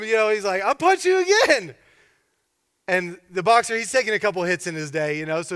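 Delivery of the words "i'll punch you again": high, loud playful threat; mock threat. Thug facing the offered cheek, mock threat. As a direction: threaten like a worked-up thug in a tale.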